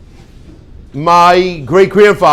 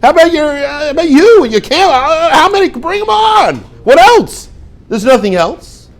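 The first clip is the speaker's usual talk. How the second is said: much higher, bubbling over with offers; eager Rivka; 9 semitones higher than usual. Acted. Rivka offers to water the camels, much higher and eager. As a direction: offer everything, can't wait to help. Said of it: "That's what Rivka said".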